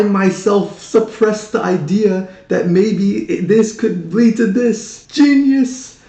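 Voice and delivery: slow, high build to an amazed peak; awestruck realization. Retold or imitated. Film fan reliving how the magic trick fooled him, awestruck. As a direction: marvel slowly, pitch climbing to the last word. Acts out awe at the twist.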